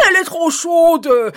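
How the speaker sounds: put-on loud cry of the old man. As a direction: call out that it's too hot. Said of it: "crie le vieux".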